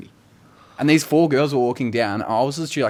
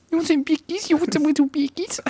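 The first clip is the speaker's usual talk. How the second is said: put-on very high, squeaky baby talk; dog-talk voice. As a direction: squeak it excitedly at a pet. Baby-talking to the dog about biscuits.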